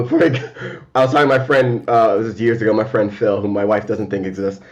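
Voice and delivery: ordinary delivery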